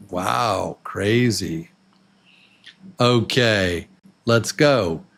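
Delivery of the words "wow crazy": ordinary delivery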